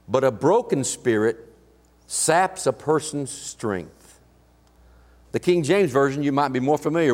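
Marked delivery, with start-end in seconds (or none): 0.1-4.2 heavy, dragging scripture line; grave, slowed verse read; slow solemn reading. Acted. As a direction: let the words sink slowly.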